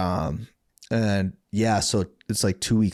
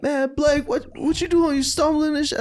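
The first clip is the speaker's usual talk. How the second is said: much higher teasing buddy voice; mock friend's voice. Friends teasing the drunk narrator, much higher mocking voice. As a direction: squeak it like a mocking homie.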